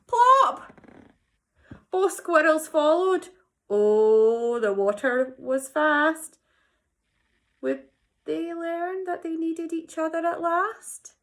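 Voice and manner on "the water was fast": ordinary delivery, nothing marked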